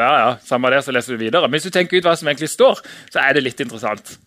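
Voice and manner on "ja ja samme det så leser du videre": low, offhand dismissal; careless shrug. Acted. Reader skimming past the verse, offhand and low. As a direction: brush it off in a lowered voice.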